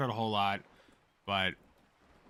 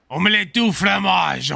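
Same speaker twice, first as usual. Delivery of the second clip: loud hammed-up French accent; cartoon French, capped with a honking laugh; 5 semitones higher, 8 dB louder than usual. Shown as a demonstration. Streamer adding one more French line and the laugh, hammed-up French accent. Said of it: "Give us your best French accent".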